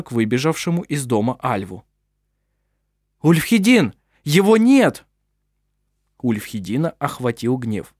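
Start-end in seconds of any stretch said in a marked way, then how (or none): 3.2-5.1 high, loud panicked cry; alarmed shout. Acted. Servant runs out in alarm.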